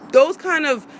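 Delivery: ordinary talk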